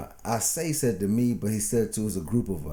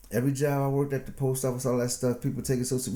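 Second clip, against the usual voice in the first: ordinary delivery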